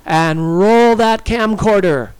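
loud, high command; crying out. A narrator's reading. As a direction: shout the order boldly. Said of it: "she cried".

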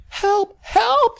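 very high, loud screams for help; shrieking. A narrator's reading. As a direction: shriek in terror. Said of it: "she shrieked".

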